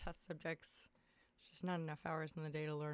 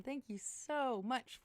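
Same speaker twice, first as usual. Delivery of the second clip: usual speaking voice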